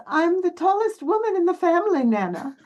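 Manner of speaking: put-on light, high young woman's voice; a hint of a proud granddaughter; granddaughter's voice. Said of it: "She says".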